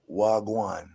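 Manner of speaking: laid-back retold Jamaican accent. Actor answers a director's request, Jamaican accent. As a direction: slip casually into a Jamaican accent. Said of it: "can you do a Jamaican accent".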